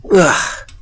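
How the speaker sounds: high, loud groan of disgust; shuddering "ugh". Acted. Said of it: "He shuddered in every limb".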